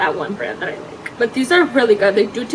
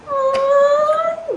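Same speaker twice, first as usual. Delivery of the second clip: high sympathetic aw. Real, not acted. Sympathy for the dog.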